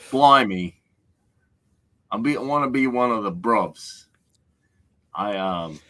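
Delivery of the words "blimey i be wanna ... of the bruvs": high, comic British-Australian mash-up; mock Anglo-Australian accent. Playing at being one of the bruvs, mock Anglo-Australian accent. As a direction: put on a bad Aussie-Brit accent. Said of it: "I'm not quite Australian".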